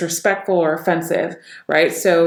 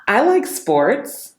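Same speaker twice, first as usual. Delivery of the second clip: upbeat and drawn out, voice lifted higher; happy; 5 semitones higher, 3 dB louder, much slower than usual. Shown as a demonstration. Coach showing happy expression while speaking, happy. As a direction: say it slowly with happy, raised pitch.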